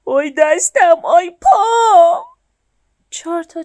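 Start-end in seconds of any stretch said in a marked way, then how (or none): 0.1-2.2 loud, higher, stretched cry of ouch; the dump truck yelling in pain as it sinks in mud. A narrator's reading. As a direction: wail it as the wheels get stuck. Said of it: "یک دفعه داد زد".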